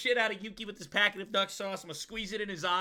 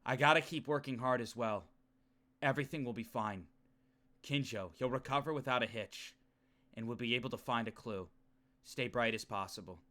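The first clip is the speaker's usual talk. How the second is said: low, soft dramatic reading; hushed, low self-talk; somber inner monologue; 10 semitones lower, 8 dB quieter than usual. Acted. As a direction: read it as troubled private thoughts, pitched low. Reads the character's inner monologue.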